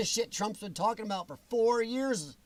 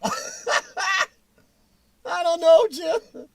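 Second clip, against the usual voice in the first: laughing reply; 8 semitones higher, 8 dB louder, much slower than usual. Real, not acted. Answering chat while laughing.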